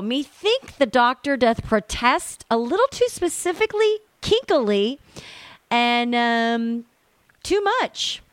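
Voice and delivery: a hint of theatrical mockery, going high on too much; mock Shakespearean jab. Guest mocking the letter writer to the host, hammed-up Shakespeare.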